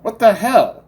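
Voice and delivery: high, loud curse; frustrated gamer cursing a glitch; angry outburst. Real, not acted. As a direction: snap at the screen. Game mishap, sudden outburst.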